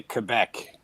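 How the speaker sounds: Quebec as kuh-BECK; Canadian pronunciation. Shown as a demonstration. Telling friends how Canada says it, kuh-BECK Quebec. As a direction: say Quebec with a short "kuh" start. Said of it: "in Canada, they just say".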